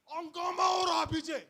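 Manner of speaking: loud uproar. Acted. Preacher describing crowd uproar.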